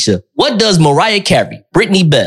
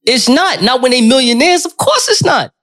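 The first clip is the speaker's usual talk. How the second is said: emphatic; 9 semitones higher than usual. Real, not acted. Emphatic agreement about cheating athletes.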